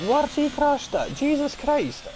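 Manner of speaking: sudden high, loud disbelief; shocked outburst. Real, not acted. Streamer reacting to a sudden crash, shocked and loud. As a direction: blurt it out, caught off guard by the crash. Driver crashes during live race.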